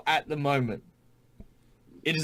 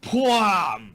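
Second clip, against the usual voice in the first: punch sound effect; 6 semitones higher, 4 dB louder than usual. Acted.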